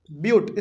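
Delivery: ब्यूट said as "byoot", with a y-glide; ब्यूट as in "byoot", not "butt"; pronounced byoot. Shown as a demonstration. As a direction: say ब्यूट with a long "yoo" sound. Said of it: "ब्यूट बोलते हैं इसको".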